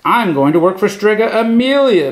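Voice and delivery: high eager exclamation; high happy announcement; excited exclaim. A narrator's reading. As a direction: blurt it out proudly. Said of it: "Big Anthony exclaimed".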